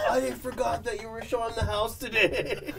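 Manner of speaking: high put-on flustered voice; imitated scared man. Agent playing the old man caught watching TV, mock scared. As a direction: make a high-pitched mock excuse. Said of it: "He's so scared".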